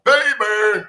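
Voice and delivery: normal talking voice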